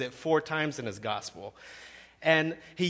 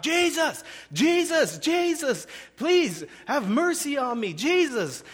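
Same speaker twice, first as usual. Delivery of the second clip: high, loud, drawn-out cries for help; shouting out; 12 semitones higher, 4 dB louder, slower than usual. Acted. Blind beggar calls to passing Jesus, desperate shouting. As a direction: call desperately over a crowd, voice pitched way up. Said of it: "he just starts shouting out".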